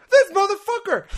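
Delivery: high, loud outraged shout; retold screaming. Player cursing a friend in the game, furious retold scream. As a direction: yell it like you've been robbed. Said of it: "I was screaming".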